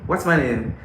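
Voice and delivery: ordinary delivery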